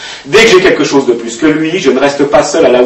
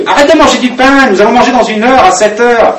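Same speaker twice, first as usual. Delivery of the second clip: high, sharp rebuke; a mild mock of the stepmother scolding; 8 semitones higher than usual. Stepmother scolding the girl for eating bread.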